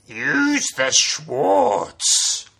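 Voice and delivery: very slow, strained sage voice; drawn-out creaky master; wise master creature. Shown as a demonstration. Spaceballs Yoda line.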